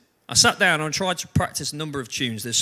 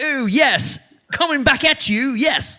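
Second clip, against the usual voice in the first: higher, louder posh schoolboy impression; 8 semitones higher, 4 dB louder, slower than usual. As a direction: put on a cool posh schoolboy voice. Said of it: "like a posh schoolboy".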